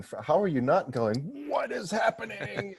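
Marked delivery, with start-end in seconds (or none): 1.5-2.7 high, drawn-out freak-out; slow, high-pitched mock bewilderment; mock alarmed disbelief. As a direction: stretch it out high, hamming up the shock. Acts out reaction to dark mass.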